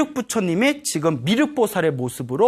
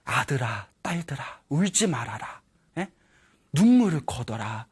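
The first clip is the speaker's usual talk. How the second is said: soft, lower, gentle fatherly voice; quietly calm the crying children; acted tender reassurance from a dying father; consoling father; 5 semitones lower, 6 dB quieter than usual.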